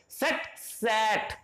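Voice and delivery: slow minimal pair सेट, सैट; set then sat. Shown as a demonstration. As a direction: pull the two vowels apart slowly. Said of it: "दोनों में difference है".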